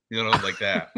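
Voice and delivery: ordinary delivery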